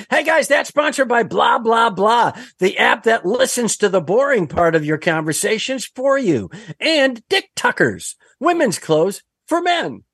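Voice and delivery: hammed-up upbeat sponsor read; mock ad read. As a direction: sell it like a cheesy ad.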